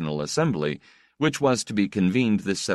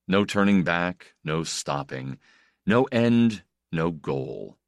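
usual speaking voice

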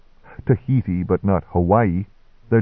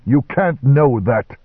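vehement; 6 semitones higher, 3 dB louder than usual. Acted. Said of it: "He flinched at my vehemence".

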